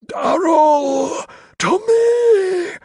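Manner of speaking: very high, loud, wailing rasp; rasping, rustling. A narrator's reading. Carstairs beckons Darrell as he crumbles, rasping wail. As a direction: call for help in a dying screech. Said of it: "His voice was the rasp and rustle".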